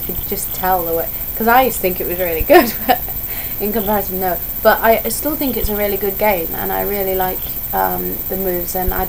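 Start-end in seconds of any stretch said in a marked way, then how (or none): none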